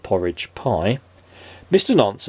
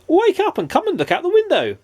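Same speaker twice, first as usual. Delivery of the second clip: 1 eager calling. Acted. Silly urges friend.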